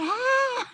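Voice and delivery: short high-pitched shriek; startled yelp. Retold or imitated. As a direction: yelp loudly in mock alarm. Startled on touching the sack.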